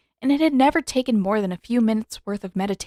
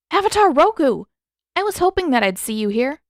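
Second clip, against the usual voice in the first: higher and louder with delight; excited greeting; 6 semitones higher, 6 dB louder than usual. Acted. Aang greets Roku with delight, higher and louder. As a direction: burst out happily to an old friend.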